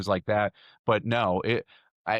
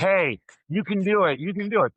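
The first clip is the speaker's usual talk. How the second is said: higher, louder mock cheer from the crowd; cheering encouragement; 8 semitones higher, 3 dB louder than usual. Audience member cheering on a nervous speaker, upbeat mock cheer. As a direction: call out encouragement like a supportive fan.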